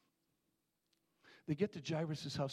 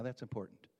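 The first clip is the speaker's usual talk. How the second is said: ordinary talk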